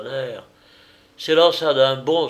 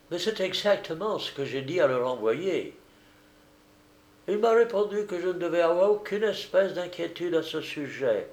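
higher character voice, composed; smooth go-between, pitched up and unperturbed; unruffled American; 6 semitones higher than usual. A narrator's reading. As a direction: reassure without losing composure. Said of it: "Sans se démonter le moindrement".

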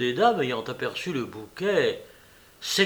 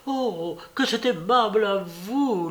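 effusive welcome; 6 semitones higher than usual. A narrator's reading. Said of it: "lui répéta-t-elle".

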